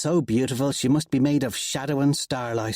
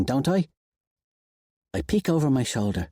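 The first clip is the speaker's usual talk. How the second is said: ordinary delivery, nothing marked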